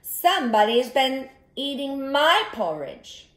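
lightly voiced mama bear; mild mama bear voice; medium-sized voice. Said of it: "in her medium-sized voice".

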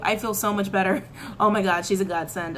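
ordinary talk, nothing marked

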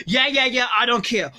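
ordinary delivery

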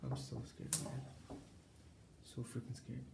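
hushed, nervous vlog voice; nervous night vlog whisper. Acted. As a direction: keep it hushed, like ghosts might hear. Hushed nervous vlog after scare.

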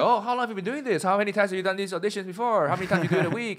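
high, rapid excited questions; over-eager new comic. Retold or imitated. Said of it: "newer comic who's very excited about comedy".

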